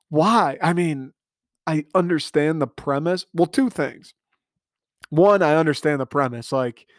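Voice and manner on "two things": ordinary talk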